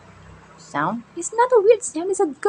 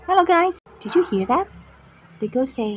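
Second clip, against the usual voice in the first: ordinary delivery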